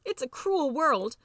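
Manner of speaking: high, slow mouse lament; slow, high-pitched melodramatic despair; wounded mouse. A narrator's reading. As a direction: draw it out in woeful self-pity. Betrayed mouse laments in the story.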